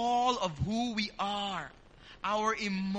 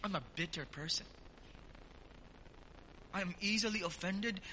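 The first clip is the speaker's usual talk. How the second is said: soft, lowered acted prayer; humble confession; 2 semitones lower, 7 dB quieter, faster than usual. Believer confessing faults to the Lord, soft and humble. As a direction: confess it quietly, as if in private prayer. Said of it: "Humanly speaking".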